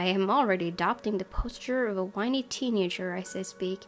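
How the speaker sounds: ordinary delivery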